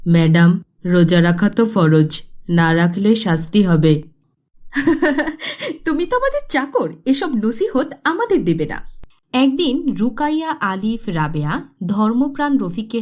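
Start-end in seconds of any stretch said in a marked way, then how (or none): 0.1-4.0 lower, louder maid urging her madam; pleading maid. Acted. Maid warns mistress. 4.6-5.8 high scornful laugh; mocking laugh. Rich woman mocks maid. 5.8-8.8 higher, fast haughty put-down; arrogant dismissal. Acted. Mistress scorns maid.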